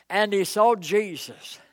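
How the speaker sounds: ordinary delivery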